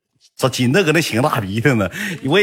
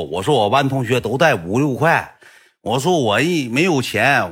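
ordinary delivery

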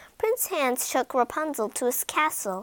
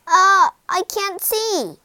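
pained; 8 semitones higher, 8 dB louder, slower than usual. Acted. Prince blinded by thorns.

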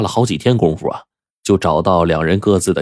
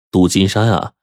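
ordinary delivery

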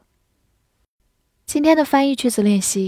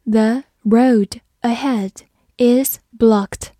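slow, careful sentence, ending whispered; slow read; much slower than usual. Shown as a demonstration. Teacher reading slowly for students to repeat. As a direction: read it slowly, let blocked fall to a whisper. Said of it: "好，慢慢来读".

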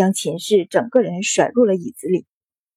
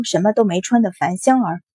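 ordinary delivery

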